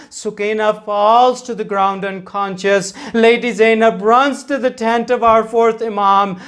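ordinary talk, nothing marked

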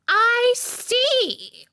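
high, loud, curt; cold royal dismissal; haughty queen. A narrator's reading. Queen eyeing the roses, high and haughty.

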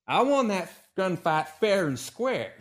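high, loud, slow snapping retort; Calamity snaps at Hiccup, loud and angry; snapped. Acted. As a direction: bite the words off at him in anger. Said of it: "she snapped".

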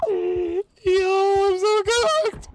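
high, slow laughing despair; panicking gamer. Real, not acted. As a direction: laugh it out, doomed.

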